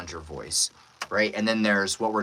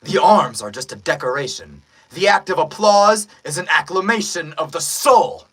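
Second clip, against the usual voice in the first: loud, heightened heroic declaration; injured fighter's comeback; 5 semitones higher, 9 dB louder than usual. Acted. Buff fighter returning one-handed to the villain, loud defiant proclamation. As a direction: proclaim it loudly, building higher to the final word. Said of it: "and he goes".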